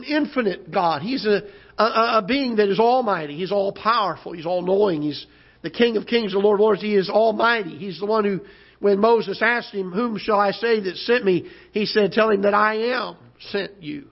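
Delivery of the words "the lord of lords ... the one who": ordinary talk, nothing marked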